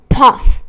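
loud, breathy burst puff; sudden loud puff of ignition; explosive puff. A narrator's reading. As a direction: burst it out like sudden flames catching. Narrator voices sudden burst of flame.

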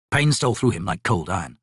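ordinary delivery, nothing marked

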